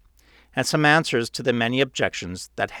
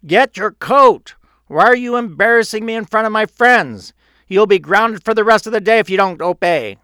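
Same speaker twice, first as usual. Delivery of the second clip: loud, high-pitched angry dad shouting; yelling; 10 semitones higher, 9 dB louder than usual. A narrator's reading. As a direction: yell orders and threats at a disobedient child. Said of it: "He was now yelling".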